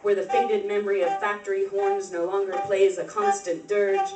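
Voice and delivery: measured, projected poetry reading in incantatory phrases; poem recitation. Acted. Poet reads an ode to South Bend to an audience, declaimed poem.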